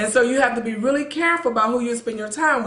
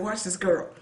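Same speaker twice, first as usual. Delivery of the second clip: hushed aside; 3 semitones lower, 8 dB quieter than usual. Retold or imitated. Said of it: "I said".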